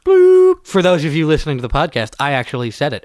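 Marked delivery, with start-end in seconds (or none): 0.1-0.7 censor beep over the word; high loud beep covering speech; bleeped out. Real, not acted. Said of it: "we had to beep it out".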